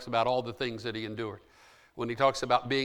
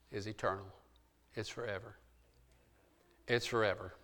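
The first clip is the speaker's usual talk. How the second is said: hushed; 5 semitones lower, 12 dB quieter than usual. Acted. Sermon close fading out.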